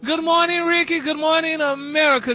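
high, lively good morning; bright on-air greeting. Real, not acted. Gary greeting Ricky and listeners, high and lively.

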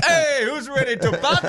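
high hype party MC; cheesy DJ hyping the crowd; wedding DJ voice. Pretend. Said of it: "sounds like a great D J, like a wedding D J".

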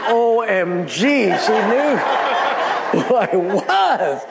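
slow, drawn-out comic amazement; hammed-up "oh my gosh" disbelief; stunned realisation. Astonished thought, acted for laughs.

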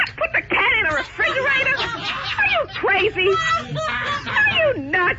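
high, incredulous and angry, rising to a shout; outraged disbelief. Real, not acted. Outraged pet owner yelling at the airline rep, high and incredulous. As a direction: she can't believe what she just heard. Customer told to fridge her cat.

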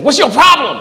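high, sharp retort; angry challenge. Retold or imitated. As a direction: snap it back at him. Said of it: "He's like".

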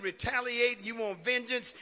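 ordinary talk

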